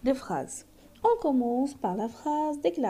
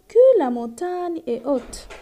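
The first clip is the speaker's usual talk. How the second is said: louder, awed "que la montagne est haute"; astonished exclamation; 7 dB louder than usual. Shown as a demonstration. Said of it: "cette phrase exprime l'étonnement".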